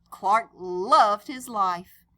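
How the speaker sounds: ordinary delivery, nothing marked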